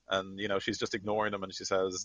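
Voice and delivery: ordinary talk, nothing marked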